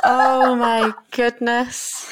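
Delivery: slow, drawn-out laughing gasp. Real, not acted. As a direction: laugh it out slowly. Reacting to punchline, laughing.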